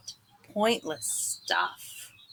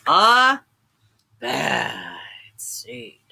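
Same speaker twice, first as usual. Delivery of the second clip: dramatic title; 5 semitones lower, much slower than usual. A narrator's reading. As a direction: build then hush. Repeated title, dramatic drop.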